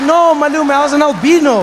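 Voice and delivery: put-on loud, high, indignant kid; insistent correction. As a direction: set him straight, loudly.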